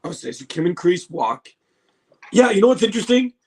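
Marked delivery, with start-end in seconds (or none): none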